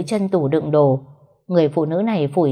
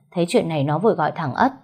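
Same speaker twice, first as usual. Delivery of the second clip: plain reading voice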